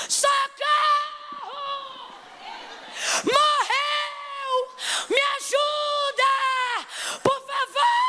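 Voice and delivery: high desperate shrieks for help; panicked screaming. Acted. Mother screaming for help over her dead son.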